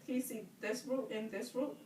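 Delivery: ordinary talk, nothing marked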